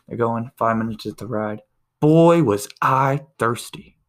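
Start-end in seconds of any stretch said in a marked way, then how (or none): 2.0-4.0 slow, loud, satisfied sigh of a line; drawn-out loud punchline. Retold or imitated. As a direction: stretch it out like the last gulp of relief. Punchline, man finally drank.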